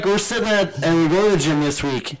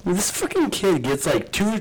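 ordinary talk, nothing marked

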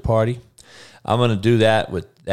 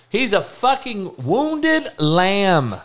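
slow emphatic jab; 10 semitones higher, much slower than usual. Real, not acted. Ranting about the player.

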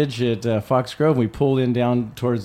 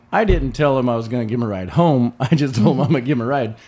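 normal talking voice

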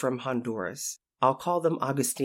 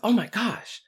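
startled; 10 semitones higher than usual. Retold or imitated. Startled caregiver in dark bathroom.

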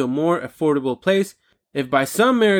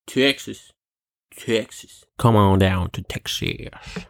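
attempted Texas accent; 9 semitones lower than usual. Shown as a demonstration. Host inviting listeners to Texas in a clumsy Texas accent. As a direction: sell Texas like a cowboy host with a mock Texas accent. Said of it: "I can't do a Texas accent".

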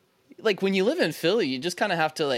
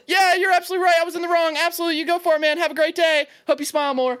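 very high, eager appeasing voice; hammed-up cowardly agreement, pitched way up; mock grovelling; 13 semitones higher, 5 dB louder, faster than usual. Would-be fighter caving to rude stranger, high mock-grovelling.

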